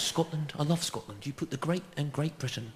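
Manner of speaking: soft, smooth politician's impression; David Cameron. Prime minister flattering Scotland in a speech, softly voiced impression. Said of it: "his speeches when he goes".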